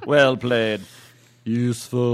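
put-on British match-commentator accent; mock British understatement. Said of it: "the Scottish guy".

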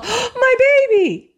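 hammed-up distraught mother; mocking the heroine's overblown cry for her child.

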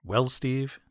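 ordinary delivery

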